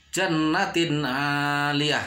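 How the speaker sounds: slow "jannatin aliyah" with a clear short n; no hum at the end of "jannatin" before "aliyah"; "jannatin aliyah", n pronounced cleanly; clear idhar reading. Shown as a demonstration. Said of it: "tidak boleh dibacanya".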